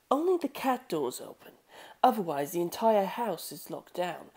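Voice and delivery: Rohan Kishibe. Acted. Rohan returning from circling the house, cool deduction. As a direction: lay out the clues calmly.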